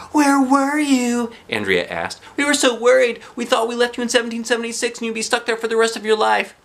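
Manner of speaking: put-on high, loud relieved girl voice; worried friend. Andrea asking Emily where she was, relieved. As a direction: pour out the worry.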